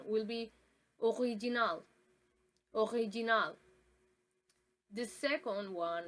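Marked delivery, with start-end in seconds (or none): 1.0-3.6 ORIGINAL with throaty r and final -nal; French pronunciation. Shown as a demonstration. Teacher quizzes viewers on language, French pronunciation of original. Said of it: "the first one is French".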